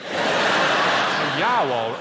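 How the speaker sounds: loud vowel-heavy Brummie repeat of an audience member; all vowels, Birmingham; mock Birmingham accent. Comic parroting a Birmingham audience member, mocking. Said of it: "That was just all vowels".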